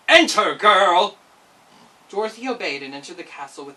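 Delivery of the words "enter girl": loud, slow, barked order; stern command. Acted. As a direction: command the girl inside, big and slow. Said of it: "in a stern, commanding tone".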